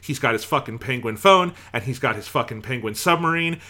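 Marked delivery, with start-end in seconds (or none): none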